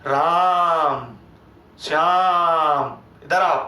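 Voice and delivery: long, loud drawn-out call of राम and Shyam. Shown as a demonstration. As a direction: call someone from far away, stretching the names. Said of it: "जो आप इस तरीके से आप बोल रहे हो".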